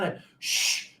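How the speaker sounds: hushed shh; nervous shushing. Retold or imitated. Anxious boater hushing his buddy at the kelp patty, whispered. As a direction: hush the crew for fear of spooking the fish. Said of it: "They're like".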